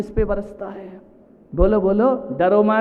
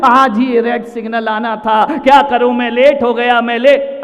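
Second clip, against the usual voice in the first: louder, exasperated driver's complaint; angry outburst; 8 dB louder than usual. Acted. Late commuter fuming at the red signal, angrily. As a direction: fume aloud at being held up. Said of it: "तुमको गुस्सा आ रहा है".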